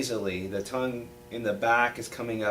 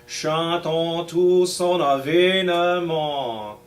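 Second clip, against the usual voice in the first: spoken smoothly in the song's rhythm, slower, higher and louder; 3 semitones higher, 5 dB louder, slower than usual. Shown as a demonstration. Teacher speaks the whole refrain in rhythm for the choir. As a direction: chant the whole French text in time with the piece. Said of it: "I'll say it smoothly in rhythm".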